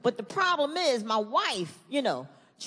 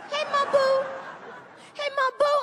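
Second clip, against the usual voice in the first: put-on little girl calling; 12 semitones higher than usual. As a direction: sing it out like a cheery kid. Daughter calling him.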